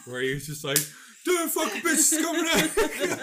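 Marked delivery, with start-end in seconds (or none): 1.3-3.2 high, slow, laughing mock of a defensive rant; mocking R. Kelly interview. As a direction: ham up a defensive celebrity. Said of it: "so defensive".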